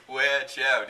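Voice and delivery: warning shout. Acted. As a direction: shout a warning across the battle. Warning in battle story.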